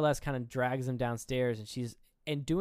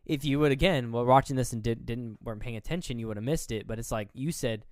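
ordinary talk, nothing marked

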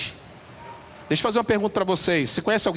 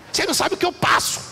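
put-on whiny child; 10 semitones higher than usual. Child complaining, voiced by preacher.

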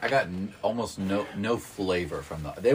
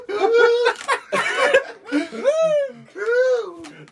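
big high-pitched laughing fit; loud high laugh; 8 dB louder than usual. Real, not acted. Laughing at the spice reaction.

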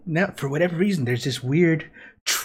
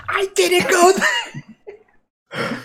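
high-pitched protest. Retold or imitated. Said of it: "his retold answer to a friend".

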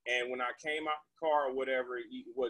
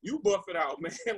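mocking retort; 6 semitones higher than usual. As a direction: throw it right back at her, squealing. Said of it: "I'm like".

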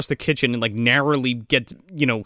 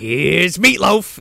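Jack Nicholson impression; 4 semitones higher, 5 dB louder, much slower than usual. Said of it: "Jack Nicholson-esque".

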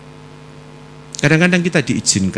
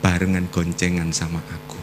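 quiet retold prayer; 5 semitones lower, 8 dB quieter than usual. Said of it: "saya selalu katakan".

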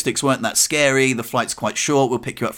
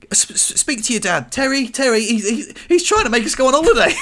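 flustered mum; 9 semitones higher than usual. Retold or imitated. Mum flustered calling to dad.

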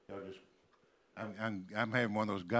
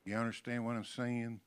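ordinary talk, nothing marked

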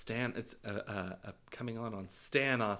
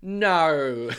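high, loud Aussie "naur"; mock Australian; 6 semitones higher, 10 dB louder than usual. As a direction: exaggerate No into a big Australian "naur". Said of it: "And then I'll be like".

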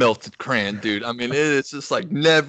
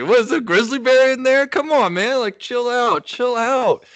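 high, loud mock plea to the gut; pleading with stomach; 11 semitones higher, 4 dB louder than usual. Pleading with his rumbling stomach, high and loud. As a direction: beg your stomach to calm down, hammed-up.

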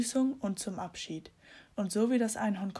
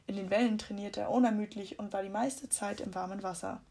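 ordinary delivery, nothing marked

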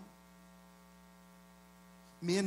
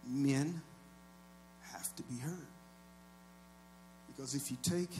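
much softer, low and intimate; hushed repeat; 5 semitones lower, 13 dB quieter than usual. Real, not acted. Pastor repeating his point to the church, hushed and intimate. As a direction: drop the volume and let it land.

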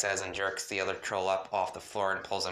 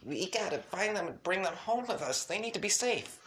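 high-pitched, urgent insistence; insistent plea; 10 semitones higher than usual. A narrator's reading. Young troll begs to bring the soulmate home, insistent. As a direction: plead in a much higher, younger-sounding voice. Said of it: "you insist".

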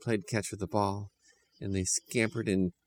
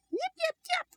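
very high tiny yips; cartoon puppy chirping; squeaky puppy voice; 3 dB quieter than usual. A narrator's reading. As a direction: yip like an excited little puppy. Puppy answers the children.

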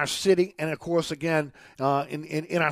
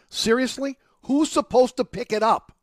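higher-pitched, exasperated questioning; indignant disbelief; 6 semitones higher, 4 dB louder than usual. Real, not acted. Radio host fed up with litterers, indignant. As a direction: demand an answer, incredulous. Indignant rant about dirty diapers in gutters.

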